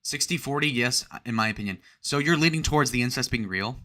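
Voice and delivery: usual speaking voice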